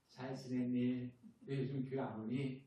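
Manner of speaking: mild pastor's gentle call; gentle coaxing. Retold or imitated. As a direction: coax them back, slowly and tenderly. Gently calling a congregant again.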